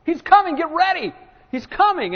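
breathless town crier announcing the groom; excited calling out. Retold or imitated. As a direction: run in shouting the groom is coming. Best man runs into town calling.

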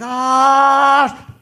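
long, high, loud yell that cuts off; mock freefall scream. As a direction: hold a loud high scream, then stop dead. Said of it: "to dead silence".

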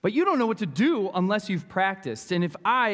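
ordinary delivery

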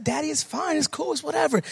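put-on high, light dismissive kid voice; imitated youngster. Son caught smoking brushing off his father, mock kid voice. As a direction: pitch it way up and shrug Dad off.

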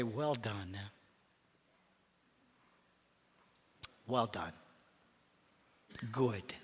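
low, soft, hushed words of welcome; tender welcome. Retold or imitated. The Savior welcoming a faithful servant home, hushed and tender. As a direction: breathe it like a tender blessing.

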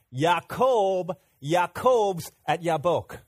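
Yaakov and Yabok punched loud and slow; Hebrew wordplay. Shown as a demonstration. Pastor spelling out the Hebrew pun to the congregation, loud and slow. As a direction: land Yaakob's at Yabok slowly, hitting each name. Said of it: "If you mix Hebrew and English".

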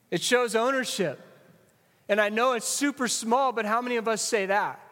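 normal talking voice

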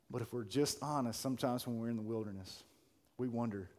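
low, soft, reflective preaching; hushed sermon opening. Real, not acted. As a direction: open the sermon quietly, leaving space between phrases.

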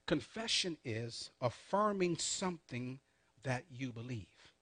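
slow, measured dictation with pauses; said slowly. Shown as a demonstration. Preacher dictates a definition to note-takers, said slowly. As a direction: give each phrase time to be written down. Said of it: "I'm gonna say this slowly".

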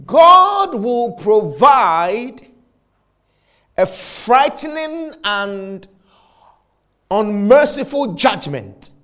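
slow, high, weighty pulpit delivery; preacher warning of judgment; solemn preaching. Acted. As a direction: hammer out each word of the warning.

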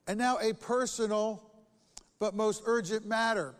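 scripture reading. Acted. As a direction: read the letter with weight. Reading Paul's letter aloud.

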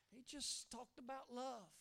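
ordinary talk

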